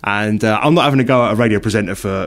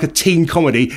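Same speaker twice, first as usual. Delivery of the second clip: normal talking voice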